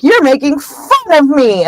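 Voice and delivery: high, wounded whine; hurt, tearful accusation. Retold or imitated. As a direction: imitate a sulker feeling mocked. Myrtle accuses, near tears.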